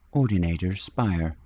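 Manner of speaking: plain reading voice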